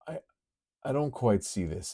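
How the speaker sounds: ordinary delivery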